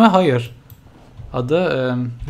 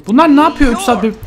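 much higher and louder, shocked; outraged shriek; 10 semitones higher, 7 dB louder than usual. Real, not acted. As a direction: shriek at the screen. Outraged at sims. (çok tiz ve yüksek, şaşkın çığlık)

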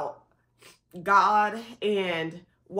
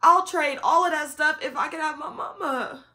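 pleading with God; 7 semitones higher than usual. Retold or imitated. Retelling her late-night prayer, pleading then fading. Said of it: "me trying not to get angry".

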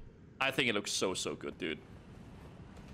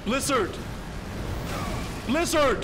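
raging gamer; 4 semitones higher, slower than usual. Real, not acted. Raging gamer in boss fight.

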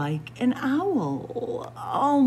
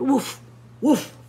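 mock dog bark; 4 semitones higher, 6 dB louder than usual. Turkey pretends to be dog.

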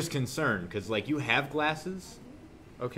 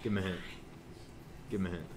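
soft plea; 7 semitones lower, 14 dB quieter, much faster than usual. Acted. Teacher asks repeatedly, building.

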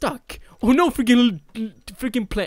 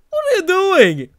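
high, loud puzzled owner; mock owner voice; 9 semitones higher, 5 dB louder than usual. Gamer voicing the owner, high and puzzled. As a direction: voice the owner searching the house.

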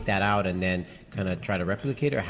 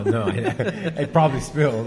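higher, louder, laughing through words; laughing denial; 4 semitones higher, 6 dB louder than usual. Real, not acted. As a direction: protest through real laughter at being teased. Laughing denial after being teased.

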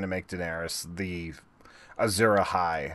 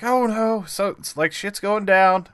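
alarmed; 11 semitones higher, 6 dB louder than usual. Retold or imitated. Fans reacting to trailer.

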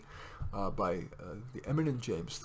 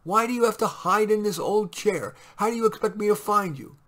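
high, loud scolding wife voice; nagging wife; 6 semitones higher, 4 dB louder than usual. A narrator's reading. Wife scolding Mitty in the lobby, high nagging. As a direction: scold him as the impatient wife.

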